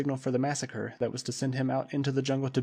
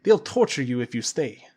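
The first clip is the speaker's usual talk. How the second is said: louder, grim man's voice; Krasky warning Bluber, grim and louder; 7 dB louder than usual. A narrator's reading. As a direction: warn him coldly. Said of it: "said Krasky".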